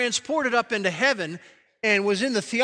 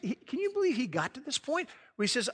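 usual speaking voice

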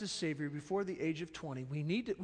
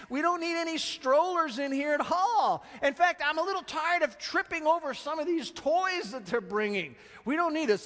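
higher, louder annoyed complaint; mock grumbling disciples; 9 semitones higher, 5 dB louder than usual. Disciples complaining about toys, mock irritated. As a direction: grumble like a put-upon doorman.

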